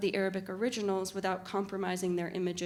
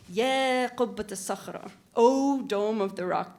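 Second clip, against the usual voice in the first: slow, weighty O at the front of the line; stretched, ceremonious address; slow vocative; 5 dB louder, slower than usual. Shown as a demonstration. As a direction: lean on the vocative, drawn out and solemn. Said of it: "these huge O's looming at the front".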